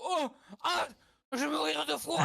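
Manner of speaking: high, loud cry of being frozen; freezing shout. Pretend. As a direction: yell that you're freezing to death. Character freezing in the game.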